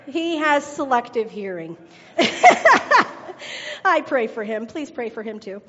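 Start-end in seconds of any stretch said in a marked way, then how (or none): 2.1-3.8 real breathy laugh, high and loud. Real, not acted. Preacher laughing about her husband, high and breathy.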